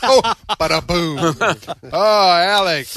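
loud, high and slow "bada boom"; excited. Real, not acted. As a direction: celebrate the punchline loudly. Punchline celebration.

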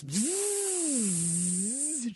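long buzzing zoom, pitch swooping up; imitated speeding zoom. Driver showing how she sped to get there, zooming. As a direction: buzz like a car racing past.

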